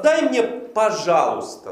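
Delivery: "Дай мне, пожалуйста" slow and loud, stress on "дай"; slow, loud polite ask with "пожалуйста"; polite request. Shown as a demonstration. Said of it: "Везде слово «пожалуйста»".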